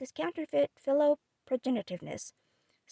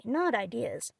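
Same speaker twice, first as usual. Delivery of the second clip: plain reading voice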